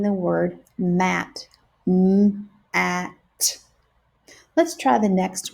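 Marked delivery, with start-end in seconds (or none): 1.9-3.6 "M-A-T" sound by sound, separated; segmented sounds. Shown as a demonstration. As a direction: say "M-A-T" one sound at a time with breaks. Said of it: "breaking words apart into their sounds".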